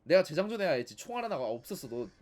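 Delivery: normal talking voice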